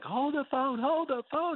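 high-pitched imitation of dad hollering; mock holler. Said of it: "he was shouting it".

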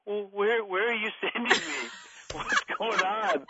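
high-pitched mock bewilderment; bewildered child. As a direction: imitate a baffled kid, high and breaking into laughter. Imitates confused child, amid laughter.

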